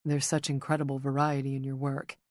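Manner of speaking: plain reading voice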